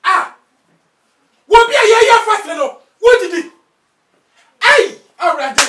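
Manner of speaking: loud furious yelling at his wife; angry shout. Acted. Husband catches wife breaking the fast, furious shout. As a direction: bellow at her for eating during the fast.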